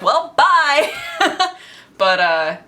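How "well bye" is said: laughing bye. Retold or imitated. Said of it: "I was like".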